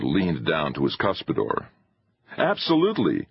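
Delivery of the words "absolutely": high-pitched, emphatic agreement; young man's keen exclamation; cried out. A narrator's reading. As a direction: cry it out eagerly. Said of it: "cried Driscoll".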